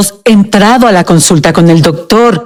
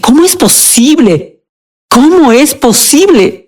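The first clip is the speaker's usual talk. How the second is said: high-pitched retold disbelief; astonished; 6 semitones higher than usual. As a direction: relive the thought, pitched up in bewilderment. Retells her astonished inner reaction. (asombro recordado, con voz más aguda)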